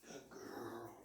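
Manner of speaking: murmured low and soft praise. Real, not acted. Trainer quietly praising her dog on the walk, soft and low. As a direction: hush it to the dog, gently pleased.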